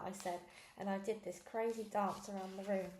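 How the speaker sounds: usual speaking voice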